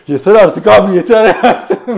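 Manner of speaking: high, loud laughing "enough"; fed up through laughter; laughing mock exasperation. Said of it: "Böyle diye".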